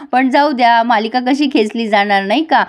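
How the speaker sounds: ordinary talk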